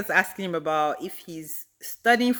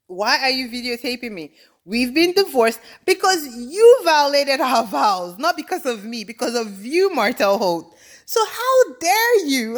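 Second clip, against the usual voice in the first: raised, higher-pitched outrage, stretching the key words; indignant ex-wife; 5 semitones higher, 4 dB louder than usual. Acted. Ex-wife confronting her stalking ex-husband, indignant. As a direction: lay into him as the wronged ex-wife, voice climbing.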